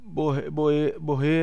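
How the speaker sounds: "borre-" low, broken off and retried; halting reading. Acted. New reader retrying a corrected surname, stuck and halting. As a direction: get stuck on "borre-" mid-word. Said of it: "seu estilo de leitura".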